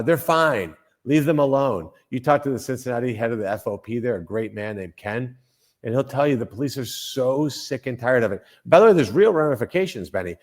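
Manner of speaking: ordinary talk, nothing marked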